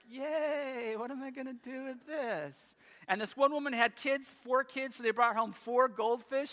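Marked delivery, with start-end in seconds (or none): 0.1-2.7 higher, fake-happy yay turning dry; sarcastic cheer, then a deadpan question; mock cheer. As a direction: pretend delight at a gift you don't want. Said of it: "the parents are like".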